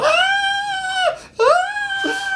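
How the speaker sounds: high-pitched mock girly shriek; hammed-up high scream running away. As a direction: shriek like a frightened little girl. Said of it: "screaming like a little girl".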